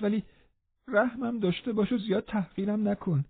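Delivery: high, soft, whining protest; protesting, pleading. A narrator's reading. Linton protesting to Catherine, high pleading whine. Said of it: "با اعتراض گفت".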